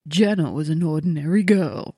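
slow put-on American film narrator; American trailer voiceover. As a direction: intone it slowly like an American movie trailer. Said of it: "like, stereotypical American".

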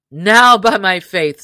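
a hint of a merry outlaw leader inviting him in; booming, good-humoured welcome, lightly played; lightly voiced hearty outlaw chief. Robin voiced in story.

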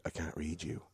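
imitated ominous psychic, hushed; soft, understated foreboding. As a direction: say it very softly, as a foreboding refusal. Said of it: "your mom was real ominous".

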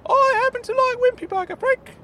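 high, loud squeaky insistence; high-pitched prim character voice; indignant Claude. Acted. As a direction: stand your ground in a high piping voice. Claude defending taste.

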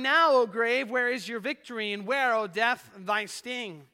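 loud, high declaimed challenge, gradually easing; triumphant shout. Acted. Procession leaving the devil's prison house, shouting in triumph. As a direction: shout it out as a victor's taunt in verse.

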